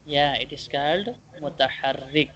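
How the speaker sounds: ordinary talk, nothing marked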